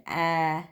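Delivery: "ah" made flat and whiny for A; whiny a sound. Shown as a demonstration. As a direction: squeeze the "ah" into a whine. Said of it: "whiny sound".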